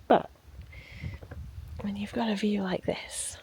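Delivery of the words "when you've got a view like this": unvoiced soft whisper. Real, not acted. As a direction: hush it like not to scare fish. Quiet watching while fishing.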